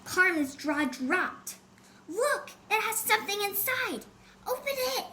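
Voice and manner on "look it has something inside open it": higher, eager outburst; excited exclaim. A narrator's reading. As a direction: gasp it out with excitement. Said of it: "she exclaimed excitedly".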